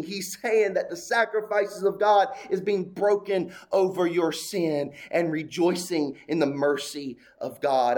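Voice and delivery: ordinary delivery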